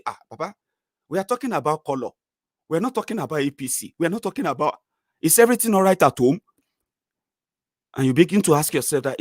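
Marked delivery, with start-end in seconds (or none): 0.0-6.4 gentle reasoning. Retold or imitated. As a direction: talk him down gently. Soothing the angry Baba.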